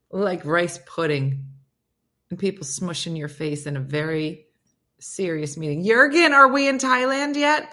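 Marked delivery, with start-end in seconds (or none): 5.8-7.7 calling out. Real, not acted. Calling out to a chat viewer.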